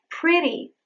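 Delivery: "pretty" with a light tapped T; flap T. Shown as a demonstration. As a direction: flick the tongue for the T in "pretty". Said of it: "when you say".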